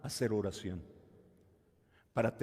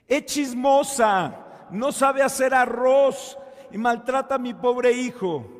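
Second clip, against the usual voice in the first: much higher, louder, slow shrill complaint; outraged mother-in-law; 10 semitones higher, 11 dB louder, slower than usual. Retold or imitated. Mother-in-law complaining to the priest about her daughter-in-law, loud and slow. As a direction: play the outraged old lady defending her son. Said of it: "¿Qué creen que me dijo?".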